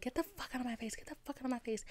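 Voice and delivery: high, held-back mock yell; retold yelling. Retelling her yelling at the hipster, mock yell kept soft. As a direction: imitate yourself yelling without the volume. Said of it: "a lot of yelling".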